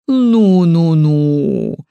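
put-on a touch of a bass old lady; мягко пробасить, осаживая; old woman's deep voice. A narrator's reading. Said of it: "повторила старуха, не дрогнув". (пробасила: «ну, ну, ну»)

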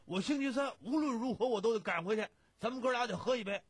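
loud, high, insistent protest. A narrator's reading. Friend urging his pal to stay for a drink, loudly insistent. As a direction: insist at the top of your voice. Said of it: "贾兴嗓门又高了".

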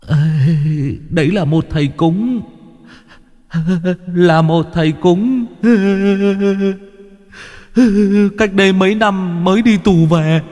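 high, whimpering confession; tearful ghost. A narrator's reading. Sobbing one-eyed ghost reveals the sorcerer, high whimper. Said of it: "khóc lóc".

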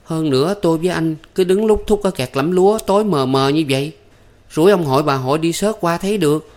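high-pitched impatient scolding; exasperated. A narrator's reading. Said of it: "Thắm dậm chân chắc lưỡi". (giọng cao, bực bội trách móc)

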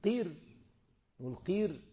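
"قير" said high with a thin light ra; light ra demo. Shown as a demonstration. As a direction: lift "قير" and keep the ra light. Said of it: "كان المفروض نقول ايه؟".

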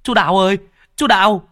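high, loud frantic calling; panicked cry. Acted. Boy runs to Uncle Đào's gate, frantic high cries. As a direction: burst in shouting the terrible news. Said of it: "tiếng gọi thất thanh".

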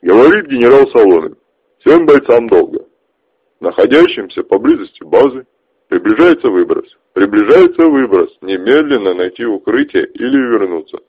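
loud radio broadcast voice; over walkie talkie. Acted. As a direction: read it like a crackling radio call. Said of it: "Оттуда послышался голос".

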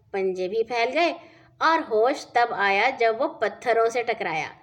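ordinary delivery

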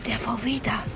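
hushed whisper. Acted. Boy whispers to his friends on spotting the suspect's car. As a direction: whisper so the men can't hear.

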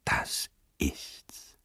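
hushed, excited whisper; leise und erregt. A narrator's reading. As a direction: breathe it out, tense and urgent. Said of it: "rief er mit leiser, aber erregter Stimme". (leise, erregt geflüstert)

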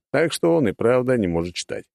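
plain reading voice

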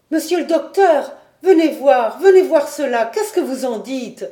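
appel fort et aigu, tout excité; crier au miracle. A narrator's reading. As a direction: appeler le docteur à pleine voix. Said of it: "Pourquoi Geoffrette crie-t-elle au miracle?". (Geoffrette appelle le docteur à grands cris)

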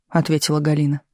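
ordinary delivery

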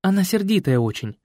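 put-on young woman voice. A narrator's reading.

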